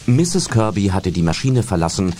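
ordinary delivery

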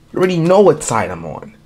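ordinary delivery

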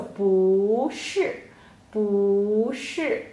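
不 stretched upward, 是 dropping after it; 不 in a rising tone before a falling 是; rising then falling. Shown as a demonstration. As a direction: glide up on 不, fall on 是. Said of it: "不 will change to a rising tone".

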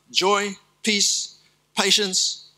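ordinary talk, nothing marked

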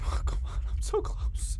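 hushed, voiceless pleading; tense, soft whispered plea; desperate whisper. Real, not acted. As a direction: whisper it as if hiding from danger. Tense plea while hiding.

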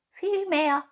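high-pitched little cat echo; cooing cat. Acted. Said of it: "säuselte die Katze".

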